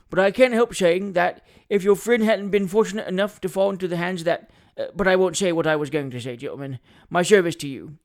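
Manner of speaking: boastful lawyer voice, trailing into false modesty; pompous attorney toasting. Acted. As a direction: play a puffed-up attorney proposing a toast.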